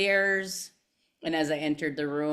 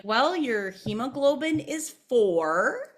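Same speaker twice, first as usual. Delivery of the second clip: slow, higher, measured doctor voice; doctor delivering bad news; 7 semitones higher, 3 dB louder, slower than usual. Doctor telling the patient her count, slow and measured. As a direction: lay out the number slowly like a grave doctor. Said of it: "And he's like".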